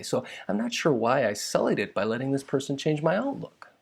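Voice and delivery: ordinary delivery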